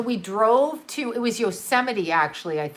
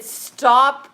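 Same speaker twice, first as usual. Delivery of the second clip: urgent command; 2 semitones higher, 6 dB louder than usual. Retold or imitated. Scared mom hushing child in tent.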